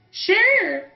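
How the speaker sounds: put-on high girl voice. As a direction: accept like a shy young woman. Goggles answers Kyle.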